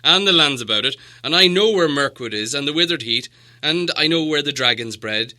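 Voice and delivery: loud, fast, proud dwarf king; proud dwarf lord. Acted. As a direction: brush off the map, pushing ahead. Thorin speaks about the mountain.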